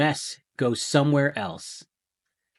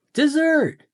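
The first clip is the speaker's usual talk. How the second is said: announcing dessert; 7 dB louder than usual. Acted.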